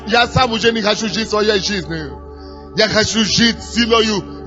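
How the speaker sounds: ordinary talk, nothing marked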